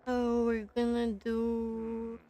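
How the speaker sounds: ordinary talk, nothing marked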